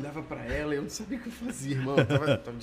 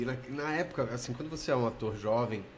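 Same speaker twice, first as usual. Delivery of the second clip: ordinary delivery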